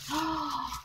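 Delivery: soft, high gasp of surprise; amazed gasp. Real, not acted. Finding another surprise in the box, amazed gasp. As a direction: gasp softly in wonder.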